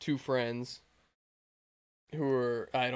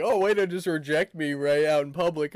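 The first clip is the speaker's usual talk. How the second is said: louder, hurt, whiny mock complaint; hammed-up wounded pride; offended whine; 3 semitones higher, 8 dB louder than usual. Woman's hurt reply, imitated.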